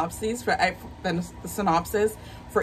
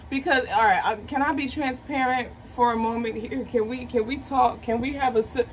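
usual speaking voice

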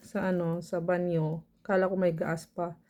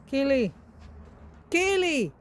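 calling out; 6 semitones higher, slower than usual. Real, not acted. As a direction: call the dog by name, rising to a shout. Calling the dog Kelly. (tinatawag nang malakas ang aso)

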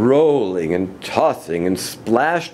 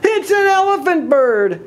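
very high, stretched exclamations; excited crowd cry, high and sustained; amazed shouting; 4 dB louder than usual. A narrator's reading. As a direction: proclaim it in thrilled astonishment. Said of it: "they shouted".

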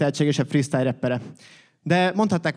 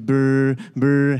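buzzing phone imitation, a bit louder; phone ring buzz; 2 semitones lower, 5 dB louder than usual. Office worker's phone buzzing as he sits down, mock ring. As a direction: rattle the lips like a ringing phone.

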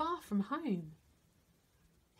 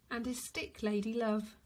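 plain reading voice

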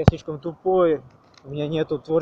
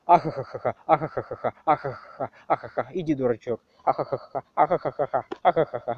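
robot laugh. Retold or imitated. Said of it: "такой вот голос робота".

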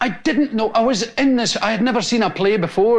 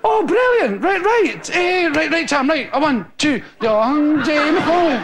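panicked count-in; 7 semitones higher than usual. Retold or imitated.

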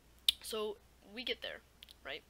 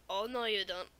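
louder, firm refusal; stern retort; 6 dB louder than usual. Retold or imitated. Strict teacher loudly refusing to let the student leave. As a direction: shut him down loudly.

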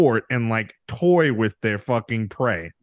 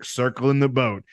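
ordinary talk

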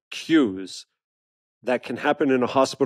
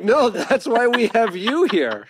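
mock retort; 9 semitones higher than usual. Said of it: "You're like".

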